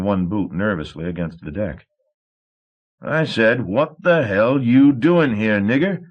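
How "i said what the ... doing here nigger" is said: higher, louder and slow, with menace; a thug warning a slave he won't ask twice; dangerous threat. A narrator's reading. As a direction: repeat the question as a slow threat. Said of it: "Sour Billy said dangerously".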